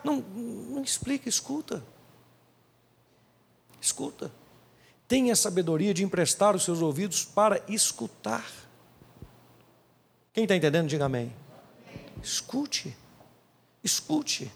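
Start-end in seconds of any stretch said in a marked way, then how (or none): 0.0-1.8 soft command. Shown as a demonstration. Preacher hushing the listener. 3.8-4.3 whispered command. Shown as a demonstration. Preacher urging the congregation to listen, whispered. 12.2-14.5 softer, hushed repeated plea; soft urging. Shown as a demonstration. Preacher pleading with the church to listen, hushed.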